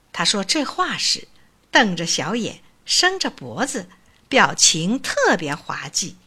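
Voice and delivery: ordinary delivery, nothing marked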